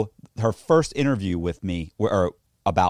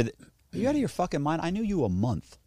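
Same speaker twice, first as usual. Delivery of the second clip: higher-pitched, exasperated disbelief; incredulous outburst; 7 semitones higher, 4 dB quieter than usual. Real, not acted. Man answering his ex's accusations, incredulous. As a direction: protest it as utterly absurd.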